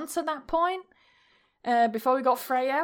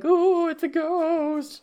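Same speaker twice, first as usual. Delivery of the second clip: drawn-out, higher teasing ghostly wail; mock spooky; 5 semitones higher, slower than usual. Boyfriend jokes to scared girlfriend about ghost, mock spooky.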